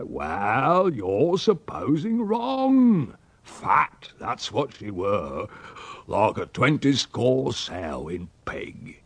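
slow, drawn-out country dialect; rustic old uncle, scornful. Acted. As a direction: contradict with relish, stretching the words.

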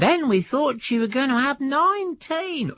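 a high, lightly voiced old grandfather; recalling the litters with wonder; grandfather's voice.

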